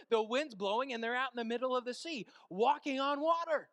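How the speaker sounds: high, loud, excited sermon storytelling; full-force preaching. Acted. Preacher marvelling at Peter walking on water, impassioned. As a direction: proclaim the miracle with mounting amazement.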